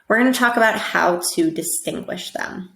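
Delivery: ordinary talk, nothing marked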